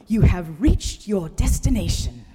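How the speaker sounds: triumphant Kiwi sat-nav announcement; triumphant New Zealand accent. Retold or imitated. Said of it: "he declares triumphantly".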